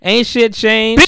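"Ain't shit changed" drawn out slow, high and loud; hammed-up gloat. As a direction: drag the taunt out in a loud sing-song. Robber repeats the taunt louder.

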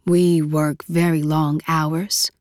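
slow, soft husky voice; shy, low husky murmur. Acted. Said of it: "in a soft, husky voice".

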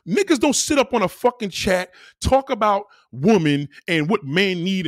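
ordinary delivery

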